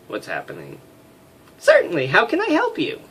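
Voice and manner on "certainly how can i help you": much higher put-on woman's voice; female voice. Flight officer greeting the major, high girly voice. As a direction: squeak it out as a chirpy young woman. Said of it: "failing at female voices".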